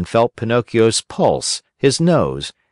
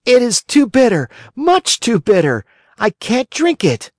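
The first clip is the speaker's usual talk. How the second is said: put-on much higher, louder childish whine; whiny puppet boy; 14 semitones higher, 3 dB louder than usual. A narrator's reading. Pinocchio refuses the fairy's bitter medicine, whining.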